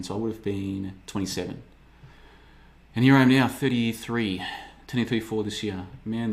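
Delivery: ordinary talk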